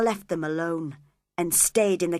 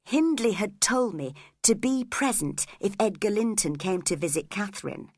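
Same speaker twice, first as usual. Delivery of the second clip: usual speaking voice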